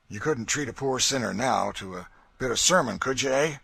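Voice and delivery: loud, sneering slaveholder voice; a brutal master mocking his victim; Legree's cruel taunt. A narrator's reading. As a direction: gloat over the beaten man. Legree mocking beaten Tom.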